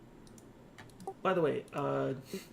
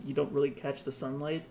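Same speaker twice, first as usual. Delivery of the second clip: ordinary talk, nothing marked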